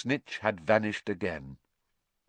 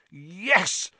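yelling; 7 semitones higher than usual. A narrator's reading. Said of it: "Ron yelled".

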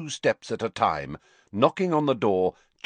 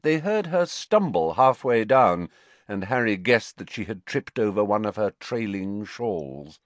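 usual speaking voice

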